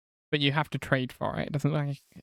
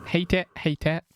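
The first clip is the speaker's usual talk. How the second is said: usual speaking voice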